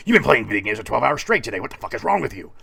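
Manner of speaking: angry drill-sergeant scolding, loud and fast. Acted. Motivator berating him for playing video games, angry. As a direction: chew him out at speed. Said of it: "screaming at me constantly".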